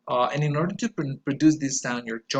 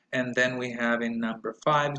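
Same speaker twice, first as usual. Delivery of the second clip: ordinary talk, nothing marked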